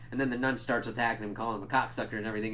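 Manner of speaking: ordinary talk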